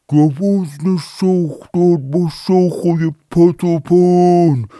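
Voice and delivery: high, very loud, slow drawn-out call; fish shouting together. Acted. All the fish call from the sea to the deer, loud and slow. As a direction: bellow it like the whole sea calling. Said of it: "داد زدن".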